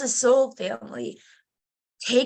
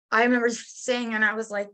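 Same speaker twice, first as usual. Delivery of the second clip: ordinary talk, nothing marked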